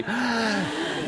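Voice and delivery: high theatrical intake; acted gasp. Shown as a demonstration. Storyteller shows children the Brahmin's gasp. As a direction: gasp audibly for others to copy.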